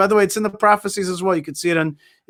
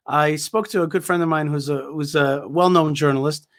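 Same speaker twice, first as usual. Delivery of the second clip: usual speaking voice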